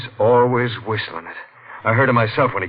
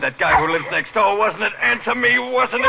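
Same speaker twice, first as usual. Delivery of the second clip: angry demand; 12 semitones higher than usual. Acted. Husband accuses his wife.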